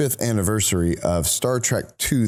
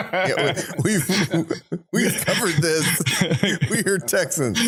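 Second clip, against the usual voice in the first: laughing; 9 semitones higher than usual. Real, not acted. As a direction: talk through real laughter, pitched up high. Laughing about their bad accents.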